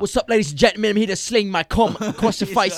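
loud, high showman's greeting; hammed-up hero entrance. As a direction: announce yourself to the crowd like a cheesy showman. Announcing as superhero villain.

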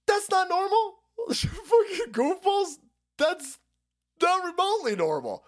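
high laughing disbelief. Real, not acted. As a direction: say it while cracking up. Laughing at his own bit.

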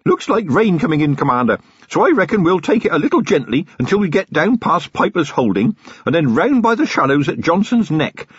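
put-on eager young constable voice; keen young constable. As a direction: chatter away eagerly at your commander. Feeney guiding the ride.

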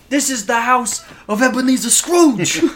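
high, loud gangster boast; Scarface. Acted. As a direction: proclaim it like an enraged drug lord. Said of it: "he's just sitting there like Scarface".

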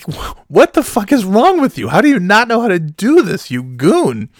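high, loud retold telling-off; mock outraged scolding; exasperated berating. Retelling Kim berating him.

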